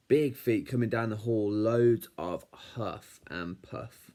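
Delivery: plain reading voice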